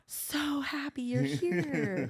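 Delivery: high, very drawn-out sweetness; sweet older lady. Retold or imitated. Preschool director welcoming little girl, high slow sweet voice. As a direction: imitate a prim director gushing, slowly. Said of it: "so sweet".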